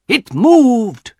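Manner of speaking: loud, high angry outburst; angry cry. A narrator's reading. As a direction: insist it moved, shouting. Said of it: "cried angrily".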